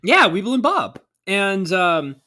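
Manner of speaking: normal talking voice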